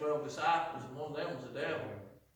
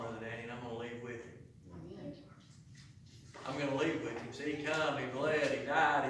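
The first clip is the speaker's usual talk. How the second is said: ordinary talk, nothing marked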